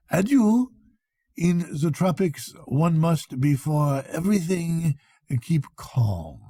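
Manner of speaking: put-on lightly accented French doctor, unhurried; a hint of French in a proud doctor's lecture. French doctor tells the Englishman about his theory, lightly accented.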